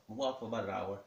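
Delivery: normal talking voice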